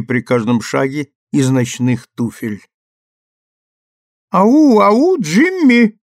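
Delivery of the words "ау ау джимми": high, loud squeal from behind a door; squealing call. Acted. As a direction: squeal it out, drawn long. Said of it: "запищала за дверью Рут".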